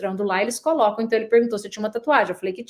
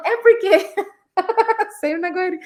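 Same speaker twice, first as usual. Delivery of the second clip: laughing it off, higher and louder; 8 semitones higher, 4 dB louder than usual. Real, not acted. Teased about always rushing, she answers laughing. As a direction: breaks into real laughter.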